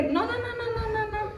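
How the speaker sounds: high, soft string of quick brush-offs; imitated refusal. Passers-by on the malecón turning down free podcasts, imitated refusal. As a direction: mimic people waving you away, high and quiet.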